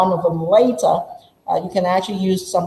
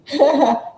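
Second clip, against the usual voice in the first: short laugh; 6 semitones higher than usual. Real, not acted. Laugh after playing the student.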